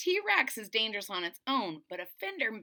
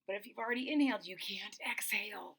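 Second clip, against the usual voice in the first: breathless little whisper; 5 dB quieter, faster than usual. Real, not acted. Telling the kids a funny secret, whispered. Joking aside about breathing rules.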